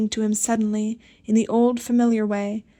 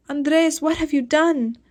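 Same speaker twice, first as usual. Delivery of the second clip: raised, anguished cry of grief; anguished lament; 6 semitones higher, slower than usual. A narrator's reading. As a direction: cry out to the dead boy in despair. Sisters lament over drowned boy.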